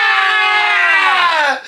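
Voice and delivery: sharp high yelp of shock; pained shout. Real, not acted. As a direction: cry out as the salts hit. Reaction to sniffing smelling salts.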